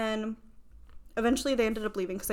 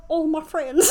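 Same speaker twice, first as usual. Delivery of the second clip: lilting Irish voice, pitched up and louder; Irish accent; 6 semitones higher, 9 dB louder than usual. Retold or imitated. Friend quoting the Irish guy in an Irish accent. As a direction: put on an Irish lilt for the quote. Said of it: "how do you do in Irish?".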